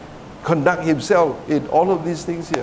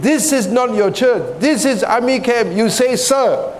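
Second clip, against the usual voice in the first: higher-pitched, emphatic mock dressing-down; retold scolding; 6 semitones higher than usual. Officer corrects recruit over army camp manners, retold scolding. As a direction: lay down the rule, stretching the final word. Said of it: "scolded him".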